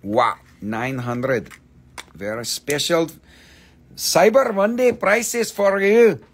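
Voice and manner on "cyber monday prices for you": excited. Real, not acted. Sales pitch flourish.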